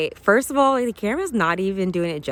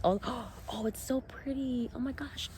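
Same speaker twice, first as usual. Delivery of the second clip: soft, high, gasping wonder; real excited awe; 7 semitones higher, 8 dB quieter than usual. Real, not acted. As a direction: gasp and marvel softly at a high pitch. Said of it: "I get excited about nature".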